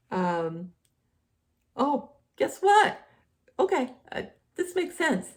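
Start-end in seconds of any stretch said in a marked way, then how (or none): 1.7-5.3 excited. Real, not acted. Sudden discovery in his notes.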